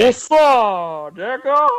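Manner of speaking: high mock greeting.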